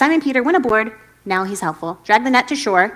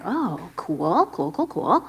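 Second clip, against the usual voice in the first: softer, lower, uneasy cool cool; awkward agreement; 5 semitones lower, 8 dB quieter, much slower than usual. Retold or imitated. Disciples accepting the job from Jesus, quietly awkward. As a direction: mumble along nervously.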